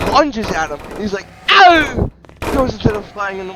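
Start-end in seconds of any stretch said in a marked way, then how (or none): 1.5-2.1 loud, high "ow"; hammed-up hurt yelp; acted yelp of pain. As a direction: yelp as if suddenly struck. Character lunged at from behind.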